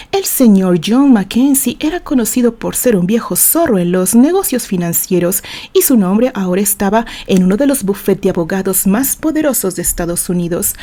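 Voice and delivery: plain reading voice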